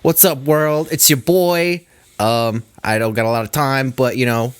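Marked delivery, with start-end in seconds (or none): 0.0-1.9 higher-pitched Brooklyn-style greeting; put-on Brooklyn accent. Real, not acted. Friend greets the whole world in his timed message, Brooklyn accent. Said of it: "why you did an accent".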